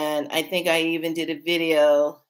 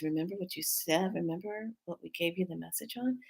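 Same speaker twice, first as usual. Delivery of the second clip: much softer, hushed message voice; soft inner voice; 12 dB quieter, faster than usual. Retold or imitated. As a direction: deliver it like a distant voice in your head. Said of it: "I get this".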